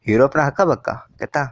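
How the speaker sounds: ordinary talk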